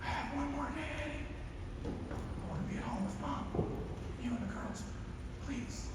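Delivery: high, very soft, begging voice; dying dad begging to go home; frail pleading father. Retold or imitated. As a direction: let the plea fade out. Father's last plea.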